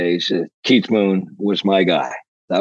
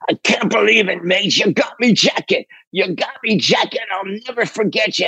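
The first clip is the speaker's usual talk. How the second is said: high, gushing British voice; 9 semitones higher than usual. Retold or imitated. Drummer thanking the man who found his jacket, high gushing impression. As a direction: imitate an overjoyed English rocker, pitched high. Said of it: "And he says".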